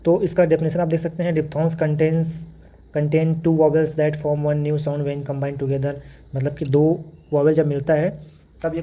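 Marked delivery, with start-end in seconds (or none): 1.3-5.9 slow dictation. Shown as a demonstration. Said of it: "teacher reads definition aloud".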